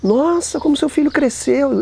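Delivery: mock-sweet high exclamation; high, gushing old lady. A woman at the market gushing over a grown child, high and sweet. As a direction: coo it like a surprised old lady.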